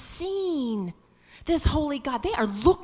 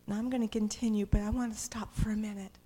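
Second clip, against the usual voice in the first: ordinary delivery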